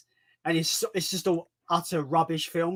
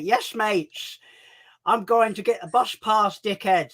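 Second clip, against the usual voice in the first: lightly done Hull chav voice, a bit higher and louder; chavvy Hull accent; 5 semitones higher, 4 dB louder, slower than usual. Retold or imitated. Streamer imitating an old YouTube chav from Hull. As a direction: slip into a mild cocky Hull chav drawl. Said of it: "he used to talk like this".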